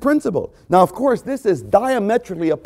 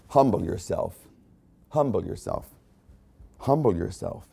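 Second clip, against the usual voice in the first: softer and lower, pressing it home; quiet, low exhortation; 7 semitones lower, 8 dB quieter than usual. Real, not acted. Preacher urging the congregation, soft and low. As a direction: drop to a low, soft repeat.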